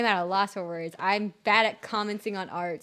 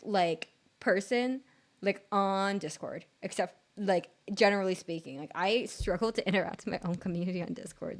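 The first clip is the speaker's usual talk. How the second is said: ordinary delivery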